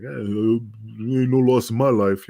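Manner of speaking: slow, shrugging indifference; dismissive Vito. Retold or imitated. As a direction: wave it off like it means nothing. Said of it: "he's like".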